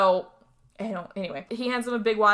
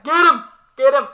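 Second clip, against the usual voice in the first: yelled much louder and higher, egging the killer on; cheering shout; 4 semitones higher, 11 dB louder than usual. Retold or imitated. Host retelling how she cheered at the screen, loud shouting. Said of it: "I was very much just like".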